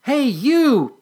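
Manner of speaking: high loud call; shouted. Acted. Patty shouting at the departing butterfly, high and loud. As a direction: shout after it as it flies off. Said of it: "Patty shouted".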